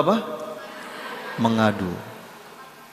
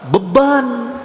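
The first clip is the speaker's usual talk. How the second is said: sudden high, loud cry on beban; exclaimed burden; 12 semitones higher, 6 dB louder than usual. Acted. Preacher to the mothers, exclaiming beban high and loud. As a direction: throw beban out as a shocked exclamation.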